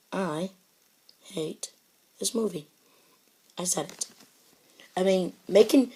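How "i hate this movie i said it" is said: much softer, a hushed forced-calm confession; calmest way possible. Acted. Admitting to viewers he hates the movie, hushed and forced calm. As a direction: hold it down, barely above a breath of calm. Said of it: "say it once in the most calmest way possible".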